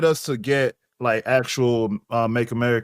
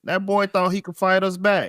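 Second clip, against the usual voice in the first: a higher, bragging fake Southern accent; bad Southern accent; 6 semitones higher than usual. Brother playing Bubba on the host's request, bad Southern accent. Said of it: "Your accents are terrible".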